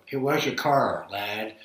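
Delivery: put-on Trenton accent with every R sounded; slow Trenton "car" with a strong R; Trenton accent, hard R. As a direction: imitate a Trenton friend leaning on the R. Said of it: "everything, he was R".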